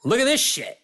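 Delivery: high, brash, swaggering dismissal; mock-arrogant swagger; cocky audition attitude. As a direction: toss it off like you own the room. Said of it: "I'm like".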